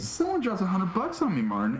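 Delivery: high-pitched mock amazement; retold freak-out. Telling a friend about a windfall, acting out amazement. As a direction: re-enact being amazed, pitch lifted. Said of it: "I, like, freaked out".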